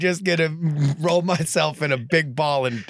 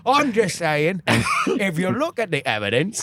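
thick hammed-up British accent; British constable turning Bricktop; 4 semitones higher than usual. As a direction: play an English heavy, pitched higher. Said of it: "Slowly evolving into Bricktop".

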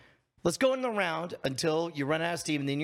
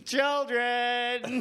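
high call with a laugh; 6 semitones higher than usual. Acted. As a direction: call out to the children. Calling the children again.

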